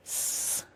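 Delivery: "s" as a long unvoiced hiss; isolated S sound. Shown as a demonstration. Teacher shows today's sound, "s" as a sustained voiceless hiss. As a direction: hold "s" alone, air only, no voice. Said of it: "Today's sounds are the S sound".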